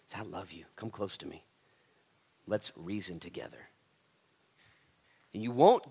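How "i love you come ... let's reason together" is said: quiet loving reassurance; tender invitation. Acted. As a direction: draw them close gently. Modelling loving confrontation.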